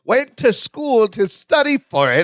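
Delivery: high voice breaking into laughter; laughing imitation of Balky; laughing while quoting. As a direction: repeat the line through giggles. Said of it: "then Balky goes".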